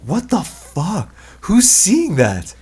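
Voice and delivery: high, slow, disbelieving; drawn-out shocked outrage; incredulous outrage. Real, not acted. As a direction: stretch it out in disbelief. Outraged at box office.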